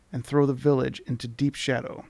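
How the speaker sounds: ordinary talk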